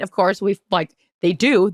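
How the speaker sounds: ordinary talk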